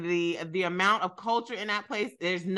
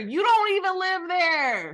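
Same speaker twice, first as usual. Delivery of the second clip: higher, slow jab; heated retort; 8 semitones higher, slower than usual. Real, not acted. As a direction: fire back at her. Argument retort.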